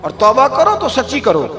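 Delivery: high, impassioned sermon pitch; climbing exhortation from the pulpit; fiery preaching. Acted. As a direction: preach it hard, urging the crowd to repent. Preacher rises to full force.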